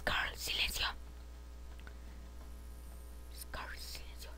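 very soft, voiceless warning; play-acted secretive hush; hushing whisper. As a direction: whisper to your companion to keep still. Hushing Carl as aliens arrive.

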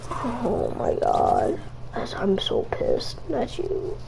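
whispered, slow, seething mutter; under-breath grumble. Real, not acted. To Tanner after dying, a whispered seething mutter. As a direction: hiss it low like you don't want him to hear.

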